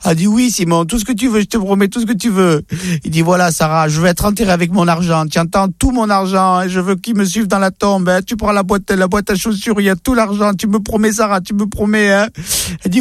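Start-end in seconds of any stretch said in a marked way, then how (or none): 0.3-2.6 wife's reply. Acted. Wife answers dying husband. 3.2-12.3 higher, pleading husband giving his last wishes; insistent dying husband. Acted. Dying husband pressing his wife to promise about the money, higher pleading voice.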